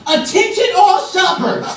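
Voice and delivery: high, loud store-announcer call, drawn out slowly; child playing PA announcer, loud and slow; intercom announcement. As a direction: announce it to the whole shop over the speakers. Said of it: "as if she's coming across the intercom".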